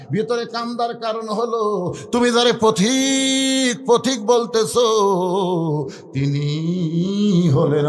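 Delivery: very slow, wailing chant with quavering endings; tearful revelation. Acted. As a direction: stretch the words into an acted sob. Tearful revelation amid weeping companions.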